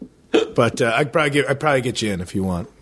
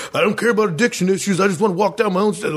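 higher, faster exasperated parody; mock irritated retort; 8 semitones higher, faster than usual. Co-host voices a neighbor arguing online, mock exasperated. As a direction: fire back like a fed-up neighbor.

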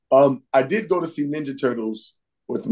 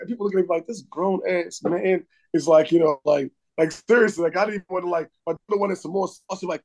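ordinary talk

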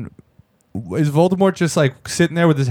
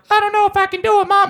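mock kid voice, much higher; whiny kid. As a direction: squeak it like a doubtful little boy. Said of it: "the kid's like".

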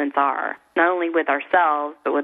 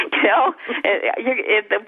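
normal talking voice

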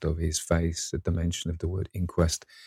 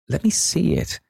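insistent demand; 11 semitones higher, 3 dB louder than usual. Acted. Painter insists on seeing portrait.

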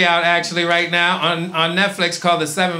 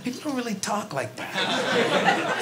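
slow, much softer musing; muttered doubt; 4 semitones higher, 9 dB quieter, slower than usual. Retold or imitated. Puzzled neighbor doubts the teen's words, slow and soft. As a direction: think it aloud to yourself. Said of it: "I'm like".

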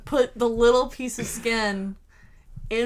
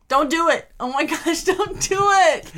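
loud, high begging that builds to a drawn-out cry; escalating half-laughing protest; laughing, exasperated plea; 7 semitones higher, 4 dB louder than usual. Real, not acted. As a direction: plead louder and higher, laughing in dread. Begging him not to do accent.

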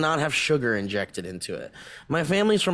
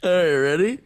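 ordinary delivery

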